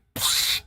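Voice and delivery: quick breathy snort laugh; short burst of air, cut off fast. Shown as a demonstration. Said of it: "like a pipe bursting real quick".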